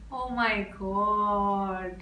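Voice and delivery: very slow, low and soft; hushed awe. Real, not acted. Listener stunned as the flower blooms, hushed awe. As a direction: breathe it out slowly, overwhelmed. Overwhelmed as the song ends.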